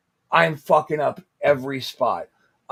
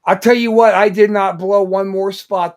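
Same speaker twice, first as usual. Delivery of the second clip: loud, raised-pitch vow, easing down; emphatic declaration; 5 semitones higher, 10 dB louder than usual. Real, not acted. Wrestler telling how the threat worked, loud emphatic declaration. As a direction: burst out with it, loud and certain.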